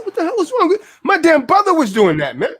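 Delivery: high, shocked exclamation; high pitched disbelief; incredulous. Retold or imitated. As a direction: act stunned by the brother. Retold shock at brother.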